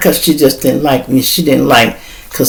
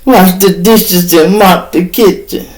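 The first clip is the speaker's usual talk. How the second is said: put-on whiny girlfriend; 5 semitones higher than usual. Girlfriend voiced in story.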